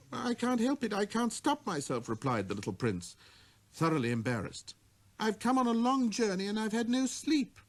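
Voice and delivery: put-on high, apologetic child's voice; little prince. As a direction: explain yourself, embarrassed and tired.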